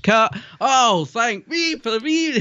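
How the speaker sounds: high, slow relieved cry; director calling cut. Pretend. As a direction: shout cut then sigh with relief. Cast swearing after filming cut.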